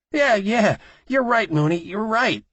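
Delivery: very high, loud scared yielding; frightened agreement. A narrator's reading. Terrified man agreeing at gunpoint.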